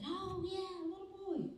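high, soft mini-cheer; mock cheering. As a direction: imitate an adoring crowd, high but hushed. Acts out a crowd cheering.

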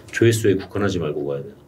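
ordinary delivery

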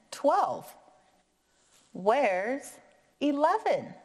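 high, puzzled where's eleven; puzzled question. Acted. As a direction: act confused about the gap. Acting puzzled at missing number.

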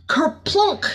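high, bouncy kerplunk; excited splash. A narrator's reading. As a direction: make the splash sound fun. Splash moment in the story.

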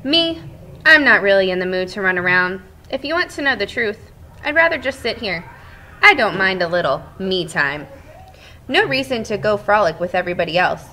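lively read-aloud voice for the class; storybook narration. Acted. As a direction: tell it like a cheerful egg to children. Teacher reads story as Shell.

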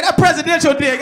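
ordinary talk, nothing marked

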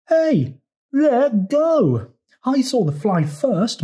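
high, tongue-tied storybook frog; mumbling Frog. A narrator's reading. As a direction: protest in a high, drawn-out character voice. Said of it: "mumbled Frog".

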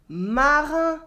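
"marin" with open nasal "an" as in French "vin"; clear French nasal vowel. Shown as a demonstration. Teacher contrasting similar French words for learners, clear nasal vowel. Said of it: "these are very different".

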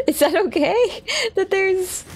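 high question with a laugh through it; nervous laugh. Real, not acted. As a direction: laugh it out, half worried.

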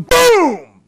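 a sharp high burst, like a dad snapping; sudden outburst. Retold or imitated. Mimicking a dad who snaps at his kid, sharp burst. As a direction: explode on the word.

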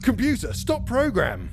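high, urgent call to the computer; urgent command. Acted. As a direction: call it out as an emergency order. Character calls out to computer.

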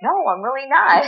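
amused brush-off with a laugh; laughing refusal. Retold or imitated. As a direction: turn him down through a laugh. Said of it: "And I'm like".

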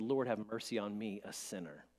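low, soft humble plea; humble tax collector. Retold or imitated. Tax collector praying at a distance, soft and humble. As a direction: plead quietly with bowed head. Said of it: "He stood at a distance and he said".

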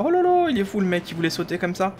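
high startled exclaim; surprised. Real, not acted. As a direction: yelp it out in surprise. NPC almost jumps off.

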